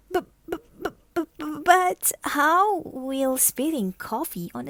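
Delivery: high, stammering fright on every b; a startled girl tripping over her words; nervous stammer. Acted. Martina stammering in alarm.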